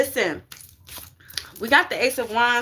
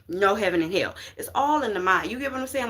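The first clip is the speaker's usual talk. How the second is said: usual speaking voice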